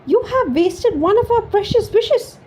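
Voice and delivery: high, loud despairing cry; cried in despair. A narrator's reading. Wife berating the woodcutter, high and despairing. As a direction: wail it out in despair. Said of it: "she cried in despair".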